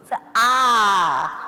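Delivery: wide loud ah with a cry; loud exercise ah; loud stretched ah. Shown as a demonstration. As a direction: shout the ah out fully. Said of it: "Really stretch".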